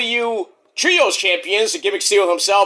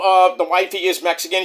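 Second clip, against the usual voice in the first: ordinary talk, nothing marked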